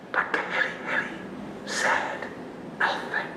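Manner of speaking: hushed storyteller's whisper. A narrator's reading. Storyteller hushes the crowd, whispering.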